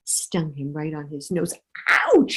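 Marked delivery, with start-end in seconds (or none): 1.9-2.4 high, loud yelp of pain; sharp cry of pain; ouch. Acted. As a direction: yelp as the sting hits. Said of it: "he said".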